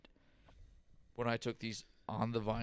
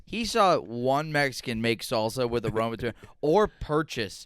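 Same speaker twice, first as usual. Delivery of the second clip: ordinary talk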